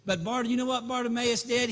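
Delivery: normal talking voice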